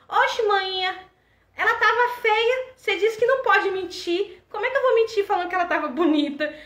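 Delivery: voz aguda de criança teimosa, bem mais alta; criança indignada. Retold or imitated. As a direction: menina protestando que não pode mentir. Filha de seis anos responde à mãe. (filha pequena se defende da mãe, com voz aguda e indignada)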